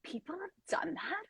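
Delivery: stunned whisper. Retold or imitated. Disbelief at her idea existing.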